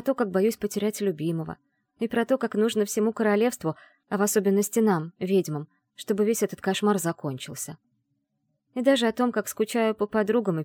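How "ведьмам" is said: plain reading voice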